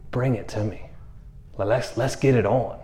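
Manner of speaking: soft, low, unruffled invitation; calm challenge. Retold or imitated. Voicing Jesus calmly inviting a challenge.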